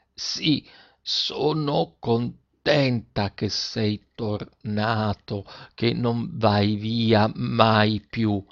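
very slow, soft, laboured syllables; deaf girl's frank answer. A narrator's reading. Deaf girl answers her father, slow strange voice. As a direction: sound out each word separately, softly. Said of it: "rispose francamente".

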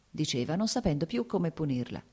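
ordinary delivery

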